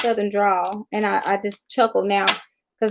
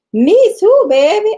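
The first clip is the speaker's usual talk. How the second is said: much higher and louder, gushing mock Southern purr; friend's flamboyant reply; 12 semitones higher, 8 dB louder, slower than usual. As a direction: camp it up as the friend agreeing. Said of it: "He said".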